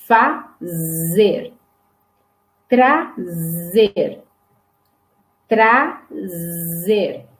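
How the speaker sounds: slow model words, vezes, fazer, trazer, with a long buzzing z; som de Z. Shown as a demonstration. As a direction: split the syllables and hold each z, as in fazer. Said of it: "este mesmo som". (vezes, fazer, trazer bem devagar, com o Z prolongado)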